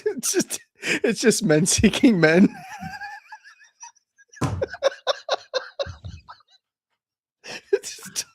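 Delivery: high, squeaky words through laughter; helpless laughter. Real, not acted. Co-host losing it over the dating segment, helpless laughter. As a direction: try to speak while cracking up.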